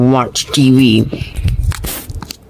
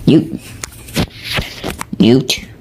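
ordinary talk, nothing marked

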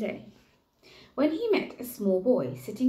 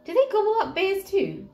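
high, worried squeak of a question; nervous; 12 semitones higher, 6 dB louder than usual. Acted. Nervous bear asking the boy, high and louder. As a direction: ask it anxiously, voice climbing. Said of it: "asked the bear nervously".

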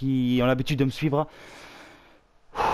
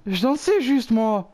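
excited whine; 10 semitones higher, slower than usual. Real, not acted. Protests while dancing in game.

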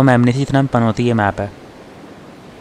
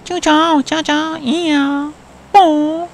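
put-on very high falsetto girl voice; man doing a woman's voice; much slower than usual. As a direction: squeak it like a girl. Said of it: "लड़की की आवाज में बोलिए".

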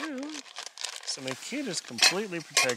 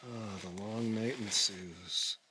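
slow, low, resigned; a weary joke about the long night ahead; wry, weary; 5 semitones lower, 6 dB quieter, slower than usual. Real, not acted. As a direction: sigh it out wearily.

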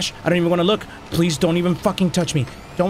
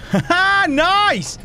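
high, triumphant ha-ha; elated high-pitched cheer; gleeful; 12 semitones higher, much slower than usual. Real, not acted. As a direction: crow with delight. Celebrates finding the item.

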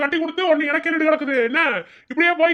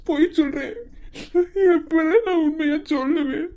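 usual speaking voice

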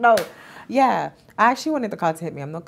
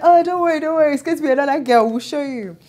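playful challenge; 8 semitones higher, 5 dB louder than usual. Retold or imitated. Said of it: "So I was like".

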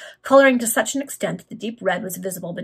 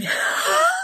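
sudden high-pitched laugh; burst of laughter; 3 dB louder than usual. Real, not acted. Reader suddenly gets the joke, real high laugh. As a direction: break off into a helpless laugh.

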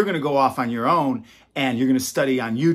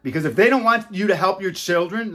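ordinary talk

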